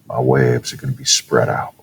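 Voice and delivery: ordinary talk, nothing marked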